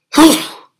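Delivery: loud real sneeze; sudden explosive sneeze. Real, not acted. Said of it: "sorry about that".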